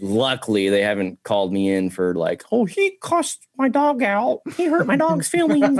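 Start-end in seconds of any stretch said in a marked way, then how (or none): 2.5-5.8 high, slow whining complaint; exaggerated high tattletale voice; mock complainer. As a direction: mimic a petty, wounded customer. Mimics a complaining customer.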